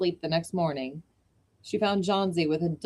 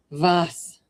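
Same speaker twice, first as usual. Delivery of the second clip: put-on a cry in a German accent; 5 dB louder than usual. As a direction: shout it out like an old German painter. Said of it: "he cried".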